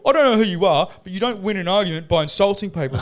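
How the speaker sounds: high, fast, loud huffy politician; hammed-up offended MP; mock politician. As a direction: play him prissy and indignant. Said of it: "He's like".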